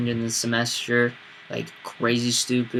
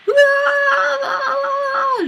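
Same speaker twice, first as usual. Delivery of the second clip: high wordless yelling while falling; jumping off the bridge, yelling wordless noise; wordless scream; 5 dB louder, much slower than usual. Retold or imitated. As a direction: scream bloody murder, no words. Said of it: "just noise".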